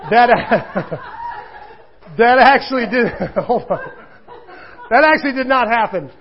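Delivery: laughing through the words, real amusement; giggling confession it never happened. Real, not acted. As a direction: crack up while admitting the joke. Laughing at own joke.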